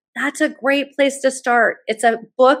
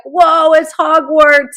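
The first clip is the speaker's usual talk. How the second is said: slow, higher and louder, wide-eyed whoa; amazed whoa; 6 semitones higher, 7 dB louder, much slower than usual. Retold or imitated. Son awed by the Harvard dining hall, slow amazed whoa. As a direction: let the awe stretch out.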